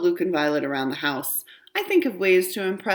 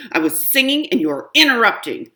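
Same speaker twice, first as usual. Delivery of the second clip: put-on louder, higher protest of a furious kid; boiling mad; 7 semitones higher, 7 dB louder than usual. Kid snaps at Mom for interrupting, loud and angry. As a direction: stamp your foot with the words. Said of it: "I am boiling mad".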